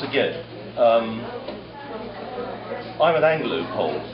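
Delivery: ordinary talk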